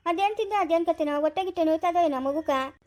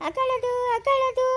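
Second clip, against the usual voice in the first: high, drawn-out singsong soothing; slow high coo to hush a crying baby; baby talk; 7 semitones higher, slower than usual. Acted. (ಮಗುವನ್ನು ಸಮಾಧಾನಿಸುವ ಎಳೆದ ಮುದ್ದು ಧ್ವನಿ)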